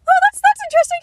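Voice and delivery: very high, loud squeak; hammed-up, squeaky attempt at casual; mock nonchalance. Telling how she answered a stranger, squeakily feigning nonchalance. Re-enacts reaction to stranger's revelation.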